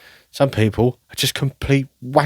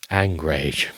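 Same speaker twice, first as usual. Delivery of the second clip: low flat coinage; 6 semitones lower, 4 dB quieter than usual. Shown as a demonstration. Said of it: "I've not thought of angrage before".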